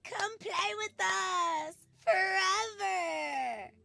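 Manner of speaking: loud, very high, slow ghostly wail; terrifying twins. Acted. Twins want Amanda to play forever, loud terrifying high wail. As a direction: wail it high and drag out the last word. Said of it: "That's terrifying".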